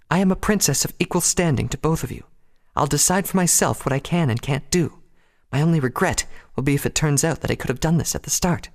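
high, firm feminine voice; high-pitched, self-possessed declaration; assertive princess. A narrator's reading. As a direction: assert your equal rank in a high voice. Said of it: "Luna's newfound assertiveness".

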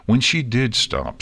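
plain reading voice

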